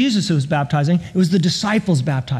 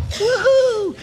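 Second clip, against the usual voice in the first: put-on little kid's woohoo. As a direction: let out a giddy little-kid cheer. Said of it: "it was so little kid".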